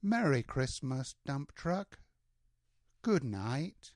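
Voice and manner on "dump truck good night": ordinary delivery, nothing marked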